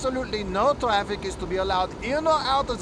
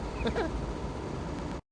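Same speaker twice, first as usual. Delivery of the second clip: breathy laugh; 6 semitones higher, 8 dB quieter than usual. Real, not acted. Soldier laughs at stranded driver.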